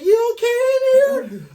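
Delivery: loud, slow falsetto imitation; drawn-out high mock concern; woman calling in. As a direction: call through the door way up high. Woman checking through bathroom door.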